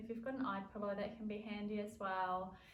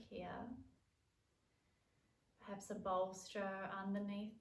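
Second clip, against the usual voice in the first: calm yoga nidra guidance with long pauses; guided relaxation. Acted. Leads a yoga nidra practice.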